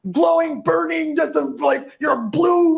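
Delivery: high, hammed-up exclaimed adjectives; mock over-emphasis. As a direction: overdo each word in a high mock shout. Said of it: "he doesn't know how to emphasize it enough".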